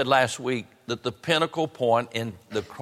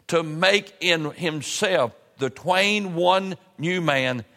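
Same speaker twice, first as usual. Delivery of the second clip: slow, raised, declaimed Bible reading; scripture reading; 5 semitones higher than usual. Real, not acted. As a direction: proclaim the verse phrase by phrase, stretching key words. Reads scripture aloud to congregation.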